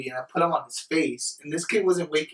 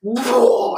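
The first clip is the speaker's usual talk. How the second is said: loud, high "whoa" whoosh; big mock heave; imitated puke gush; 8 semitones higher, 7 dB louder than usual. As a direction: mimic a sudden gush of vomit. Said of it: "It's like".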